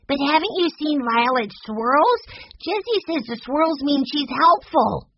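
put-on high, excited little boy voice; eager, high-pitched kid character; Benny crying out. A narrator's reading. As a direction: pipe up insistently like a small child. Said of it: "cried Benny".

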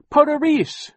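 put-on very high, loud baby shriek. Baby Sunny shrieking at the creature holding her. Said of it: "Sunny shrieked".

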